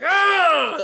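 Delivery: high, loud yell jolting out of sleep; startled mock scream. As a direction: imitate waking up screaming. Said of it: "Like, out of a sleep".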